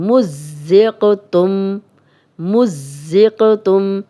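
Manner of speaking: مُزِّقْتُمْ with a doubled ز and a bounced ق, slowly; slow tajweed drill; doubled zay and qalqalah. Shown as a demonstration. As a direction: press the ز and bounce the ق. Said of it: "شد ہے تو دو بار پڑھیں گے".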